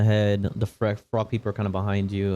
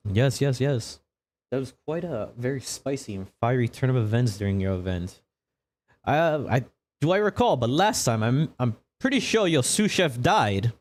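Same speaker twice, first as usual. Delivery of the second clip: higher, lilting presenter probing a guest; singsong host voice, pitched up; showy TV interviewer; 4 semitones higher than usual. Acted. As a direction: interview with theatrical, nosy relish.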